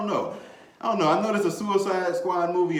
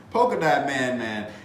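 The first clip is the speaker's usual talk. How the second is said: ordinary talk, nothing marked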